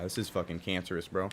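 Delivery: ordinary talk, nothing marked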